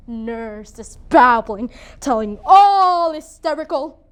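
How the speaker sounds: emphatic, drawn-out dramatic narration; scornful noblewoman. Acted. Lady Capulet watches the nurse telling all, scornful. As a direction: stretch the words with rising force.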